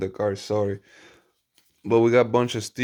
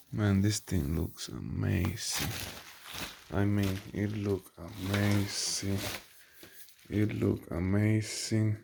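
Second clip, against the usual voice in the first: awed hush; 2 semitones lower, 9 dB quieter, slower than usual. Real, not acted. Admiring the exhaust up close.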